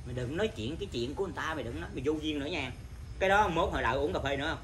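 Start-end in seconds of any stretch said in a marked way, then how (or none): 0.1-2.7 quick, lower mock telling-off; imitated owner's scolding. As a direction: imitate an owner curtly reproving a customer. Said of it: "Không lẽ chủ với con ra con này".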